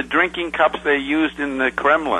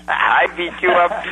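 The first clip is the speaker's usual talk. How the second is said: laughing; 5 semitones higher, slower than usual. Real, not acted. Joking between laughs.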